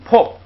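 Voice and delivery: 破 said high and pushed out; model pronunciation. Shown as a demonstration. Teacher contrasts the two 破 readings for the class, high and firm. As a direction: show the difference in 破 clearly. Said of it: "两个不一样".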